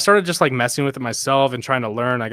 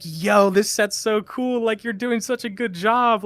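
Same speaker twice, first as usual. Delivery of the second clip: much higher, slow gushing praise; imitating fanboy praise; 10 semitones higher, slower than usual. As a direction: fawn over the DJ in a high voice. Complimenting the DJ.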